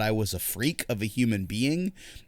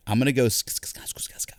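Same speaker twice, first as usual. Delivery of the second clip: ASMR whisper; faster than usual. Retold or imitated. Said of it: "people that are just like".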